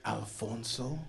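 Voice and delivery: quiet, questioning jab at the name; lightly needling, rising at the end; mocking name-calling. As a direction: say the name softly as a pointed question. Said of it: "Why are you saying my name like that?".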